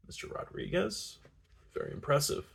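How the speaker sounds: hushed, frosty courtesy; icily polite. A narrator's reading. Jealous man greeting her friend, icily polite. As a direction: greet a rival with soft, cold politeness.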